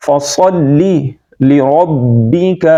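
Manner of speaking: "فصلی لربک" slow with thick s and r; heavy saad and r. Shown as a demonstration. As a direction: round out "فصلی لربک" syllable by syllable. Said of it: "را کو پھر موٹا پڑھیں گے".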